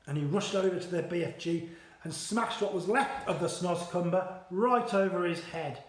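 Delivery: ordinary delivery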